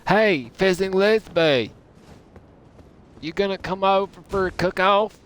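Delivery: high, loud southern holler; neighborly southern accent. Pretend. Southerner inviting a neighbor to a cook-off, hollered southern accent. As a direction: call out in a hammed-up southern accent. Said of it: "like my neighbor when you do this accent".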